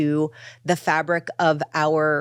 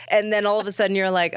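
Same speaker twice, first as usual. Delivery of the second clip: usual speaking voice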